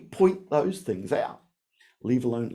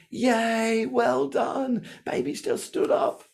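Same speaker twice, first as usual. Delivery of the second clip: high, excited parent cheering; 10 semitones higher, much slower than usual. Retold or imitated. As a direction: cheer the baby on like a proud parent. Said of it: "as parents, we say".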